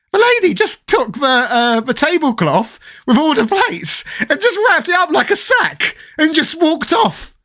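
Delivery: horrified retelling. Real, not acted. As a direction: act out the disbelief, voice way up. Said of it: "I was horrified".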